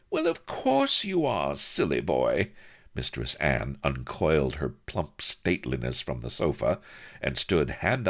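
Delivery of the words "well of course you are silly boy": very high, cooing indulgence; coy teasing. A narrator's reading. Lady indulging the captain, coy and teasing. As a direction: coo it high, dropping at the end.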